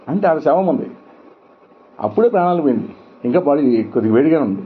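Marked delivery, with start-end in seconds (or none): none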